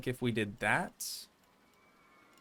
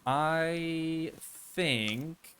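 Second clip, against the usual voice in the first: ordinary talk, nothing marked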